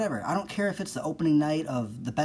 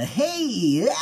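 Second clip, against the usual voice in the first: much higher, louder burst of fake enthusiasm; mock hyped cheer; 10 semitones higher, 6 dB louder than usual. Podcaster mimicking an enthusiastic person's loud cheer. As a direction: shout it like an over-eager fan. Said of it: "those noises were I just made".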